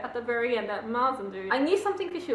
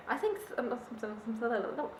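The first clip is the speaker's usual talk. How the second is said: stumbling over the word some; real stammer, words catching; stuttering; 10 dB quieter than usual. Real, not acted. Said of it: "I think I'm stuttering like Cook is".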